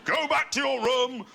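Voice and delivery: high, put-on voice of an outraged father; mock-furious bellow, imitating dad; shouting dad. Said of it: "started shouting at them".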